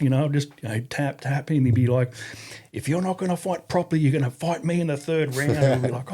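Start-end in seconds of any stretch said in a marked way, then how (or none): 2.7-5.5 high, stern warning; RSM threat. Retold or imitated. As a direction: threaten them from the ring side.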